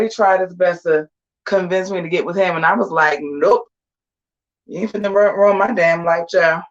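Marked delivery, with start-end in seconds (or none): none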